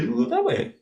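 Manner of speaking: the second way jumps way up high; high mock squeak; squeaky high. Shown as a demonstration. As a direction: pitch the words up for the other way. Pointing the other way, jump up.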